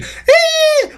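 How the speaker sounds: very high-pitched squealing imitation scream; mock scream. Reviewer mocking a whiny character's screaming, mock scream. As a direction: squeal like a panicking character, without extra volume. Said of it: "him screaming".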